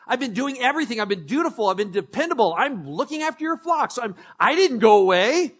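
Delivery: high, loud whining complaint; whiny outrage. Acted. Older brother whining at his dad about a goat, loud high whine. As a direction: throw the grievance at dad like a sulking kid. Preacher voices the sulking brother.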